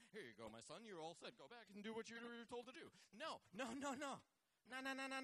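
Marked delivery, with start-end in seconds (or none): 0.1-2.9 soft, gentle handing-over voice; gentle fatherly voice. Retold or imitated. Preacher voicing Elisha handing back the axe gently. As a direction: play the kind helper, softly.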